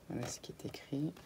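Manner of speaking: soft whisper while showing the page; whispered aside. Real, not acted. Showing her viewers the notebook page, a whisper. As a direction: murmur it under your breath.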